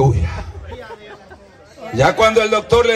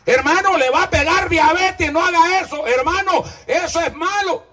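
mock pleading warning; 10 semitones higher than usual. Preacher mimics a brother warning about diabetes, high mock pleading. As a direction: beg him like a worried friend, pitched high. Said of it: "Sí, ahí hable así".

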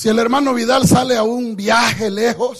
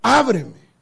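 soft, lower, loving plea; tender call; 5 semitones lower, 4 dB quieter than usual. Retold or imitated. The beloved calls softly to his bride, tender. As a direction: coax her gently to open the door. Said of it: "con un gran amor".

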